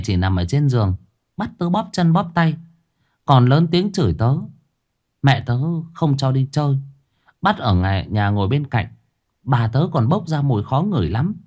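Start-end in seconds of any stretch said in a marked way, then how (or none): 1.4-11.3 put-on little girl voice. Girl complaining about grandmother.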